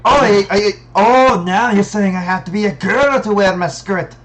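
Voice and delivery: put-on loud, high, indignant Scottish accent. Player quoting a cartoon Scot about a skirt, in a Scottish accent. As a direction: roar it out as an outraged mock Scot. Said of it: "quoting the Samurai Jack quote".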